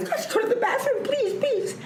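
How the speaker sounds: very high, squealed begging; tearful pleading, reenacted. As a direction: beg as if starting to cry, played up. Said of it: "I'm starting to cry".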